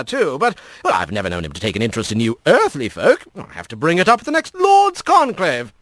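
lively, swooping high fox voice; chatty lord with big leaps in pitch; lordly fox creature. Acted. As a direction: play the breezy fox lord. Voices the Kit Fox in story.

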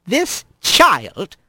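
hard, measured rebuke. Acted. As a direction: say it slowly and firmly, brooking no argument. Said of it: "her voice as hard as Rat had ever heard it".

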